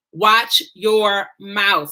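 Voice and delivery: "Watch your mouth" very slow, word by word; each word of "Watch your mouth" drawn out and separate; emphatic warning. Real, not acted. Drives home the talk's title.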